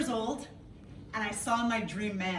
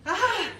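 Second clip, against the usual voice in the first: high, loud startled yelp; mock cry; 10 semitones higher, 6 dB louder than usual. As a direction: yelp like you're dodging a car. Mock honk panic.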